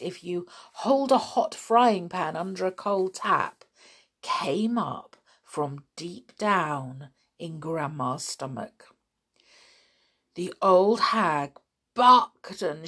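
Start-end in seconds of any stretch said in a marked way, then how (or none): none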